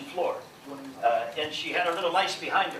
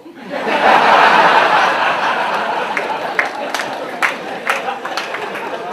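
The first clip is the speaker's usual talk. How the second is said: loud laugh; 11 dB louder than usual. Real, not acted. Audience laughter.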